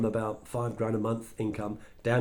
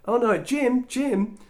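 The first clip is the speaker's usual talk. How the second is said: much higher, bright call across the street; 11 semitones higher, 4 dB louder, much slower than usual. Retold or imitated. Tish calling Jim over, high bright call. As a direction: wave him over.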